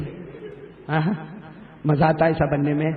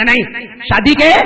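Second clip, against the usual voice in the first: put-on young man refusing; 5 semitones higher, 10 dB louder, much slower than usual.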